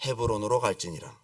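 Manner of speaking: low, subdued scripture answer; calm divine answer. Acted. Preacher reading God's answer to David, low and calm. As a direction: read the command low and settled.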